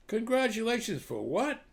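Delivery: high, rising amazed question; amazed echo. Acted. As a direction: toss it back in disbelief. Saint Peter echoes, amazed.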